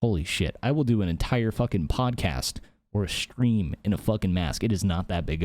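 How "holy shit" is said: ordinary talk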